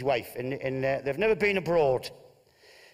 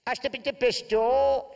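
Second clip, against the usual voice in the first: mangled Spanish; 9 semitones higher, 4 dB louder, slower than usual. Acted. Wife attempts Spanish.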